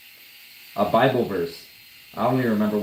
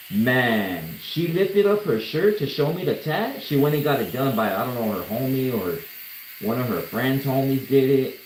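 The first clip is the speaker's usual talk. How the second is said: normal talking voice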